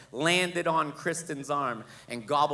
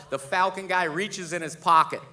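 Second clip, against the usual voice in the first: ordinary talk, nothing marked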